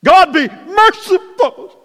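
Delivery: high, loud, slow desperate plea; Isaiah crying out before a holy God, loud and anguished. Acted. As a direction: cry it out as one undone. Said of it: "As Isaiah said".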